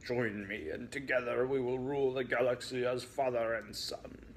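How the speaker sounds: a hint of Darth Vader; mildly growled bad Vader; Vader impression. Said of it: "he growled in his best Vader im".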